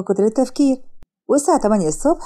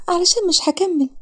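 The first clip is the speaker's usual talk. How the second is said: put-on higher girl's voice on the edge of tears; tearful girl; 8 semitones higher than usual. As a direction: hold back tears, barely getting it out. Sara voiced high, tearful. (بنت على وشك البكا بصوت أعلى)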